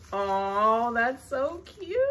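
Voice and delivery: drawn-out coo swooping up high; charmed coo. Real, not acted. Collector admiring kissing Claus figures, charmed coo. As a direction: gush slowly over something adorable.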